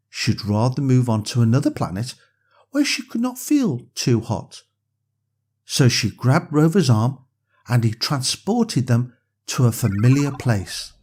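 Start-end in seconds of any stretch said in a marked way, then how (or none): none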